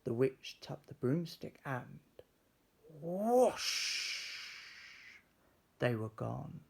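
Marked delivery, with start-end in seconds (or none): 3.0-4.5 high, louder swooping whoosh. A narrator's reading. Broomstick taking off, high swooping whoosh. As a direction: sweep up into the sky.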